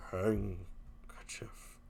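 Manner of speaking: low, slow, breathless threat; menacing whisper. Acted. Husband hisses at wife after his outburst. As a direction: hiss it out quietly.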